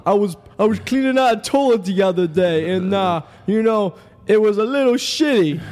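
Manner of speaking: high, slow Guido accent, hammed-up; a hammed-up Guido plumber doing stand-up; Guido plumber act. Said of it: "I'll be Tony the plumber".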